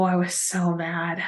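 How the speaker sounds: quiet, slow, still stewing; quietly mad. Real, not acted. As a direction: say it under your breath, slowly. Reliving anger at the chef.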